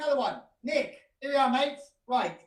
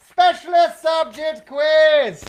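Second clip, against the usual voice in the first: slow, loud game-show title call; announcer shout; 5 semitones higher, 12 dB louder, much slower than usual. Shown as a demonstration. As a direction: bellow the title like a quiz host. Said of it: "I'm not gonna do the graphics".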